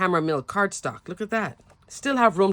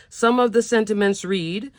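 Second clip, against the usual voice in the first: ordinary talk, nothing marked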